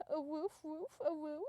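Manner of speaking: high, playful cartoon woofs; small dog yipping cutely in words; cute little dog. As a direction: bark the words in a high puppy voice. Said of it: "then Enderman was like".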